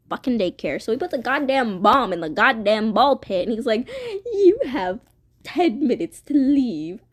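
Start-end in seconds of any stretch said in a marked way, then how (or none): 4.2-7.0 higher, put-on menacing announcement; theatrical villain countdown; mock villain warning. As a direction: announce the threat like a cartoon villain. Acts villain announcing bomb.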